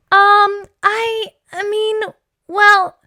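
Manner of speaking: high, halting stammer; flustered hesitation. Acted. Father put on the spot by Taya, flustered. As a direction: stall awkwardly, searching for words.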